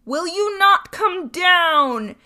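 very loud, slow, high roar; furious bawl. A narrator's reading. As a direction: bellow the threat slowly and loud. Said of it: "bawled Bluebeard".

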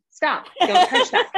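mock stern mom; laughing parent scolding. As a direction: bark orders at a toddler while laughing. Mom scolding toddler in store.

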